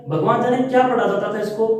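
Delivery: ordinary talk, nothing marked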